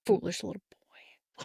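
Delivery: sinister villain whisper; dark lord. Pretend. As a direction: hiss it like a scheming dark lord.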